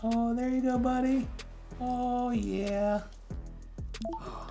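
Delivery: put-on baby talk to plant. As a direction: coo at the plant like a toddler. Talks to plant again.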